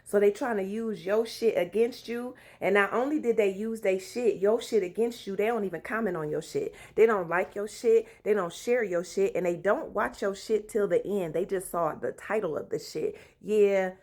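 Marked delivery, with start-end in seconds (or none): none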